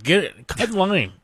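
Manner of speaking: usual speaking voice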